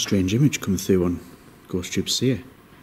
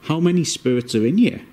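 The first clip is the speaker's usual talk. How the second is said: calling out; 7 semitones higher, 4 dB louder than usual. Real, not acted. Calling out to spirits.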